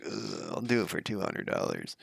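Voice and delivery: hushed imitation of a reluctant mover; reluctant mover's voice. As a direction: groan first, then imitate him reluctantly quoting a price. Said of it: "that's, like, the voice he used".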